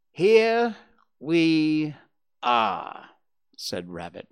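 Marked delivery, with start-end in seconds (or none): 0.1-3.1 each word drawn out, pauses between; slow and careful. A narrator's reading. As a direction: start high and loud, then step down word by word. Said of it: "very slowly and carefully".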